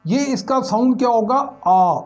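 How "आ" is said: a drawn-out open "आ", like the a in "last"; long aa sound. Shown as a demonstration. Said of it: "आ sound".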